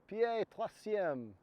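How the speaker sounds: slow, careful French with an American accent; terrible French accent. Shown as a demonstration. Teaching hikers the French name, in a self-mocking French accent. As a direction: spell out pied troisième in clumsy American-accented French. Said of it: "There's my terrible French accent again".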